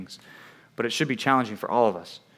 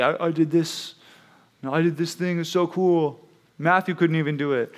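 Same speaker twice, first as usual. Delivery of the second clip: mildly bragging, pitched up a little; boastful; 3 semitones higher than usual. Retold or imitated. Child bragging over beating a brother at something, mildly boastful. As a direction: brag like a proud sibling. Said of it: "whenever I would brag or boast or say".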